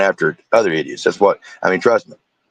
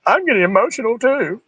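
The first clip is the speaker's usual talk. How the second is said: high, put-on tearful act; mock emotional; 11 semitones higher than usual. Host ridiculing her emotional confession, mock emotional. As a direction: fake being moved in a squeaky voice.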